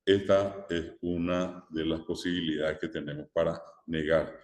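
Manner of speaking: ordinary talk, nothing marked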